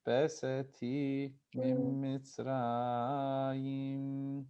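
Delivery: slow, drawn-out chanted reading of each phrase; very slow read-along. Shown as a demonstration. Teacher reading the paragraph slowly for students to follow, drawn out. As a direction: lead the class through the Hebrew word by word, slowly. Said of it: "Let's do that again".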